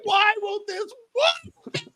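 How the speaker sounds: very high, drawn-out fake crying; mock upset wail. Host imitating the wife upset over a broken water fountain, wailing. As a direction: whine it out slowly like a hammed-up sob. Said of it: "She was more upset".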